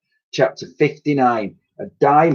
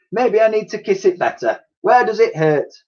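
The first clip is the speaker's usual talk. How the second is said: much higher, caring lady voice; Dotty's voice; 10 semitones higher than usual. A narrator's reading. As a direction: voice her like a worried sweetheart. Dotty flirts.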